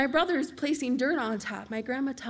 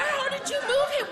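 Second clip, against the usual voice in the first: much higher, laughing outburst; incredulous question; 14 semitones higher, 3 dB louder than usual. Retold or imitated. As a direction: squeak it out in shock. Shocked reply to grandma's news.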